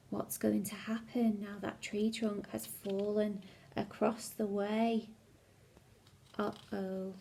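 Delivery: hushed whisper of suspense; whispered aside. Acted. Storyteller whispering suspense to children. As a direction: breathe it like a secret.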